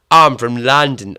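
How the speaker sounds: loud, hammed-up London Cockney retry; Danny Dyer Cockney. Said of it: "why do they all sound like Danny Dyer".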